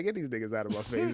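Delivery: fast, scornful wave-off; dismissive brush-off. Acted. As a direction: brush them away quickly. Dismissive punchline about the Colts.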